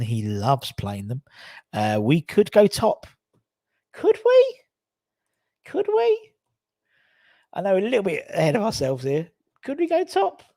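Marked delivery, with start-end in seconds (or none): 3.9-6.3 much higher and louder, squeaky hope; giddy disbelief. Acted. Fan daring to dream of going top, squeaky excited disbelief. 9.6-10.4 giddy disbelief. Acted. Fan daring to dream of going top, squeaky excited disbelief.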